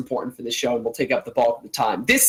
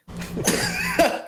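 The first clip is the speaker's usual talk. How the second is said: a sudden high giggle; high-pitched laugh. Real, not acted. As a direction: break into a squeaky laugh. Coughing fit turns into laughter.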